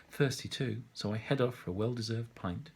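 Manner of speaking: ordinary delivery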